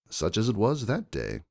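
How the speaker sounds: ordinary delivery, nothing marked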